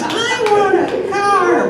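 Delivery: put-on loud, high-pitched child's demand; imitated kid. Kid answering Santa's Christmas question, high and loud. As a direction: blurt it out like an eager child. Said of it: "kids would come up".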